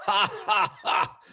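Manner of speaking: high put-on "ha, ha, ha"; mock cop laugh. Cop mocking Gary's shoe joke, hammed-up laugh. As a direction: ham up the cops laughing at his joke. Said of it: "they all laugh".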